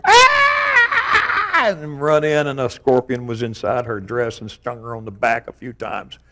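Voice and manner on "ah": blood-curdling scream. Retold or imitated. Sister screaming after the scorpion stung her, a shriek. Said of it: "blood-curdling scream".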